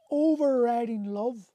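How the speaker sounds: usual speaking voice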